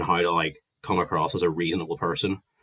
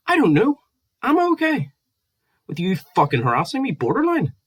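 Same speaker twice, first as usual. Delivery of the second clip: exasperated mock reply; 14 semitones higher, 5 dB louder than usual. Retold exasperated reply to Gareth.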